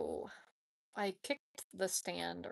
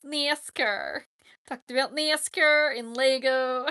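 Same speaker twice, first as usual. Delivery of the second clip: loud, hammed-up Southern twang; obnoxious nasally Southern accent; 3 semitones higher, 9 dB louder, slower than usual. Shown as a demonstration. Streamer kidding about nascar and Lego, obnoxious nasal Southern accent. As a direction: push a mock Southern accent through the nose. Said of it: "with a nasally, like, the southern, obnoxious".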